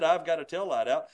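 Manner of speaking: innocent questioning rise on out; feigned surprise. Shown as a demonstration. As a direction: act like it is news to you. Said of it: "with voice inflection".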